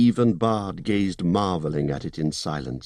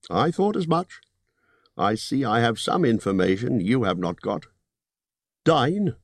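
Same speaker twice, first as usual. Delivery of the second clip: 高めの声で念を押すホビット; 利益の取り分を強調する商談口調; Bilbo's voice; 4 semitones higher than usual. Acted.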